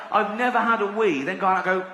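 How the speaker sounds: normal talking voice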